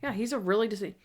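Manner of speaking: ordinary talk, nothing marked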